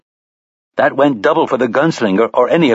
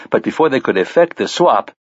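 ordinary delivery

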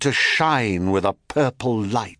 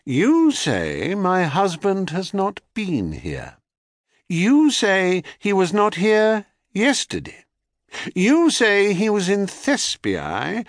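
a touch of cold menace, higher; cold, controlled interrogation; icy calm; 9 semitones higher than usual. A narrator's reading. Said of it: "said the goddess with icy calm".

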